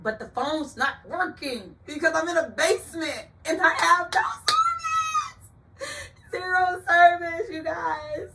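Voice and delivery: high, loud rising panic, near tears; panicked. Retold or imitated. No phone service in the club basement, high panicked crying. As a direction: cry out the panic, voice climbing high. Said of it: "fight or flight kick in".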